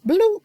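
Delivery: high, popped "bloop"; playful bloop. Real, not acted. Capping girlfriend's confessional remark, playful high bloop. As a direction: drop in a high little sound effect. Punctuates retold shady remark.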